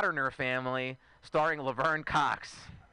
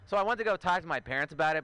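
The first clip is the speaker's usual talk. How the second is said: ordinary talk, nothing marked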